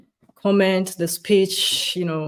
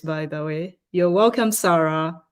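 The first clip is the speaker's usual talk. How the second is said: normal talking voice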